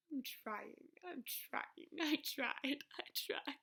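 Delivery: small, faltering repeated plea; breaking down. Acted. Exhausted poet insisting on the effort, faltering. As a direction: let the repeated words crumble under strain.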